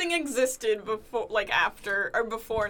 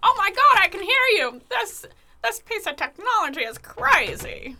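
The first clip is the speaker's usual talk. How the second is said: excited shouting; 10 semitones higher, 7 dB louder than usual. Pretend. Pretend first phone call.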